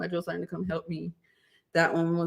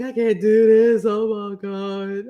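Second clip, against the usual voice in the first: slow, drawn-out tearful whine; mock crying; 3 semitones higher, 6 dB louder, slower than usual. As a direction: imitate giving up in tears, dragging the words. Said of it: "I started crying".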